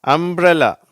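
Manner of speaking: அம்ப்ரெல்லா said high and loud with mbr blended; model word umbrella. Shown as a demonstration. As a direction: pronounce அம்ப்ரெல்லா carefully. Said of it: "அப்ப எப்படி வருது".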